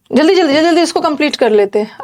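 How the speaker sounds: quick, rushed rattle of words; hurried. Real, not acted. Teacher hurrying the class to finish, hurried. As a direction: rush it along to wrap up.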